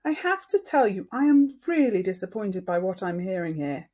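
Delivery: high, loud, upset lecture; disappointed mother. Acted. Mother lecturing both boys, high, loud and upset. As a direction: pour out her disappointment. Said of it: "she looked like how she sounded".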